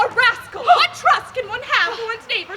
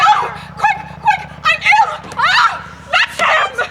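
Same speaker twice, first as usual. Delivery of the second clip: high, loud and panicked; frantic shout; 10 semitones higher, 4 dB louder, much slower than usual. Acted. Daughter suddenly wanting the suitor back, high and frantic. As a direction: scream for him to come back.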